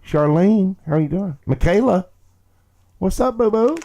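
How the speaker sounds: loud, high sing-out to arriving friends; excited greeting. Real, not acted. Host welcoming viewers by name, high excited shout-out. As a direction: call out names, high and loud.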